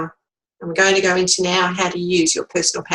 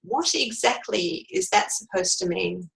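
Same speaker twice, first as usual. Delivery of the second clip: slow, soft, icy question; very cool; 6 dB quieter than usual. Shown as a demonstration. Coach showing a cool reply to a bully, slow and softer. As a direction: ask it coolly and slowly. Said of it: "you could very coolly say".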